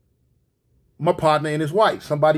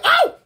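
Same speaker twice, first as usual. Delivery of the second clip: short, high startled yelp; sudden loud "Oh!" shriek; mimicked startled scream; little scream; 6 dB louder than usual. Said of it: "made a little scream like".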